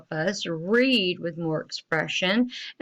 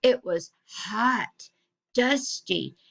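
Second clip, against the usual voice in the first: "hot" and "dusty" each set apart with feeling; stressed adjectives; 3 semitones higher, slower than usual. Shown as a demonstration. Teacher pointing out adjectives, "hot" and "dusty" stressed. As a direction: lean slowly on "hot", pause, then "dusty". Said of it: "he added these adjectives".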